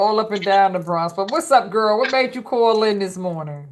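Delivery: usual speaking voice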